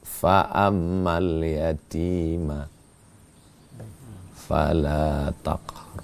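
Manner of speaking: slow, low, drawn-out chanted verse; slow Quran recitation. Acted. Preacher reciting the verse to the congregation, slow and chanted. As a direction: intone each Arabic word slowly and reverently.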